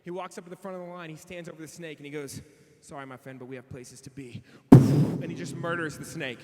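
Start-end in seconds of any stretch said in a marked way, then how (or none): 2.8-4.4 cool hero. Retold or imitated. Cool camper addresses the snake.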